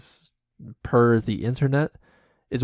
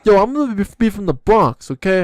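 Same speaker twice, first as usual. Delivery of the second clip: put-on Bronx accent; 8 semitones higher, 6 dB louder than usual. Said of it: "talk a little bit like this".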